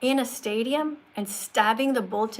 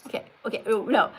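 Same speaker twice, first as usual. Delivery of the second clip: usual speaking voice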